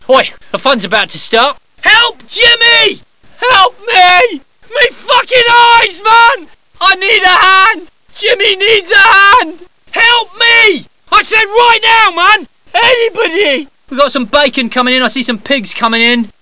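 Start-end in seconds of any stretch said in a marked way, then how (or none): 1.8-13.7 high pained yelling for help; desperate cries for help. Acted. Blinded in combat, screaming to his crew for help. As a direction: scream for help, eyes burning.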